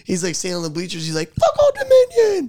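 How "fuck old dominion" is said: very high, loud drawn-out yell; mock stadium yell. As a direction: bellow it from the bleachers like a hyped player. Running back joins the chant.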